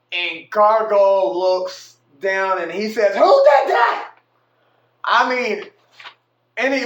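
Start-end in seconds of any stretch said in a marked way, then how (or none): 3.1-4.1 much higher, much louder furious demand; angry shout. Retold or imitated. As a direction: bellow it like a boss catching a culprit. Gargoyle spots the fifty dollar bills.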